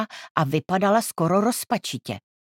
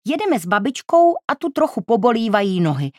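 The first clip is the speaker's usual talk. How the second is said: aunt's voice; 6 semitones higher, 4 dB louder than usual. A narrator's reading. Aunt explains about grandma.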